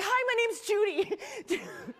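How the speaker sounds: high, slow, awkwardly chirpy hello; a nervous speaker forcing a bright hello; awkward nervous greeting. Retold or imitated. Nervous speaker greets the stranger in line, awkward and high. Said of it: "when I get really nervous, I'm so awkward".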